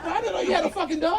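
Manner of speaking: very high put-on voice; high-pitched mock surprise; surprised visitor. Acting the visitor finding the dog.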